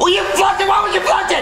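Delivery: high, incredulous yell; outraged shouting. Acted. Donkey learns Shrek flushed his hat, outraged shouting. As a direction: yell in angry disbelief.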